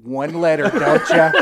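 loud, high taunt, laughing; mocking outburst. Kid taunting the teacher over spelling A, loud and high. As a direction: throw it at her, half laughing.

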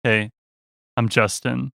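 a hint of a flat, downcast stranger; glum imitated greeting. [name] imitating the upset stranger's downcast hello. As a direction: say his hello like he's just been dumped. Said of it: "sorry, wrong tone".

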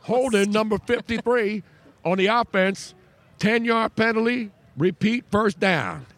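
slow, high, drawn-out referee call; NFL referee announcement. Pretend. Radio host hamming up a referee's penalty call for his co-host. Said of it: "Let me finish my call".

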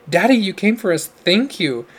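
high, loud grateful cry; near scream. A narrator's reading. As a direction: nearly scream it at him. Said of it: "Marcia nearly screamed at him".

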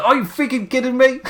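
high, indignant tennis-tantrum yell; John McEnroe outburst. Retold or imitated. Mimicking Gargano's furious McEnroe-style complaint. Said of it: "summoning his inner John McEnroe".

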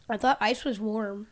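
ordinary delivery

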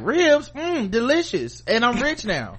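high, loud, savoring mmm over ribs; hammed-up delight at food; satisfied mmm. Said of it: "I wanna still be like".

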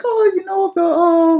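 Stewie from Family Guy. Acted. Co-host imitating Stewie to a friend, high-pitched impression. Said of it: "Do you remember when he was like".

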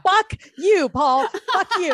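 loud, high outburst of mock outrage; retold freak-out. Retelling her reply to friend's baby news, exasperated outburst. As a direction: curse him out in exasperated relief. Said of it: "freaking out".

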